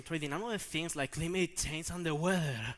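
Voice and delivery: usual speaking voice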